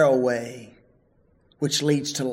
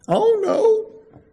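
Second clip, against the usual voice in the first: put-on kid's dismay; 4 dB louder than usual. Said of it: "what I asked him".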